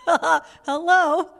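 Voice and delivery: ordinary talk, nothing marked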